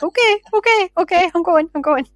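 retold hasty retreat. Host retelling her reply to fed-up Ian, high mock backing-off. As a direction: act out giving in, pitched well up. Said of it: "And I'm like".

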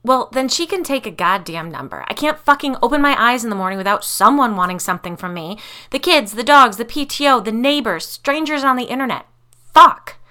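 exasperated rant building to a shouted curse; fed-up tirade, rising to a yell; angry outburst. A narrator's reading. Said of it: "after my little outburst".

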